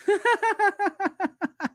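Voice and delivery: high, rippling giggle; laugh. Real, not acted. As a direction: giggle in a long high run. Own laugh at friend's comment.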